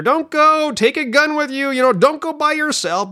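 high, urgent mock yelling; mock screaming at screen. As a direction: viewers from the couch warning the heroine. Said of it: "the couch is screaming at her".

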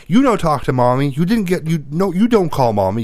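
put-on kid accusing. Son accuses her.

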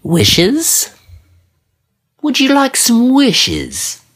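very high, stretched-out genie voice. Pretend. Genie offering wishes to a visitor, high put-on voice.

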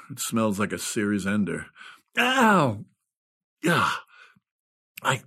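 ordinary talk, nothing marked

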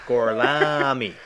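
put-on slow, drawn-out Italian accent with a laugh. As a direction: stretch the name out in a hammy Italian accent. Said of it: "him doing an Italian accent".

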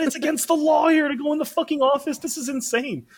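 high, outraged mock boss voice; hammed-up disbelief at German law. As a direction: play the indignant boss, way up high. Retelling boss's outrage.

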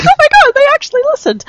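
high squealing surprise; amazed. Retold or imitated. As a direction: squeal with disbelief. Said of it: "I'm like".

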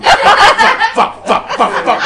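high, loud laughing bursts of fuck; mock tic swearing. Teacher pretends his swearing tic to colleagues, high laughing bursts. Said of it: "my own little personal kind of Tourette's".

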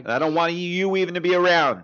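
loud fake outrage, telling him off; mock angry shout. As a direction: bark it like a fed-up host. Mock outburst continues.

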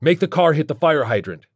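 urgent yelled command; urgent shout. A narrator's reading. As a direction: shout instructions in an emergency.

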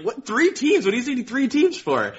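very high, baffled questioning; squeaked-up mock astonishment; retold incredulity. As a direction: act out comic disbelief, pitch leaping up. Retold disbelief at three teams.

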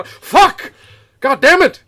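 high, very loud cursing; hammed-up exasperated yell; furious acted outburst. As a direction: explode in mock fury.

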